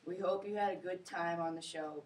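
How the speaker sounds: usual speaking voice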